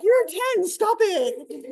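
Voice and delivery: hammed-up parental outrage, high and loud; mock scolding. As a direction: playfully scold a ten-year-old. Mom scolding ten-year-old in story.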